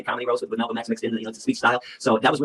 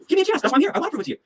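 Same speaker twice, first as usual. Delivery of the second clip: high, urgent appeal; worked-up high voice, replaying his pitch; impassioned plea; faster than usual. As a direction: plead earnestly to be given a chance.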